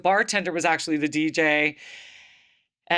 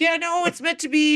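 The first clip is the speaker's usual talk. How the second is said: high-pitched, excited mock pleading; eager insistence; 10 semitones higher than usual. Teller re-enacts persuading her reluctant friend, high eager insistence. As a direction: push it high, playfully insistent.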